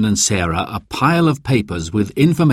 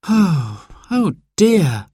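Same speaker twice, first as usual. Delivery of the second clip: put-on high, mildly let-down little boy; a touch of sulky disappointment; disappointed sigh; 9 semitones higher, much slower than usual. A narrator's reading. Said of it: "sighed Simon".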